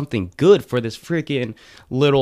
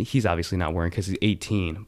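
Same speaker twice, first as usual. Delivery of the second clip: ordinary talk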